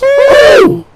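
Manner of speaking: piercing high shriek; insulted coaches shrieking at Sir Handel; outraged coaches scream. A narrator's reading. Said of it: "screamed Agnes, Ruth, Lucy".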